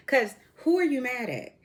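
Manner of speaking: ordinary talk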